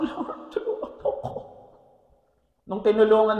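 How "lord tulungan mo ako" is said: whispered plea for help; pleading whisper. Retold or imitated. Worshipper crying to the Lord for help, whispered. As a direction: beg softly as in tearful prayer.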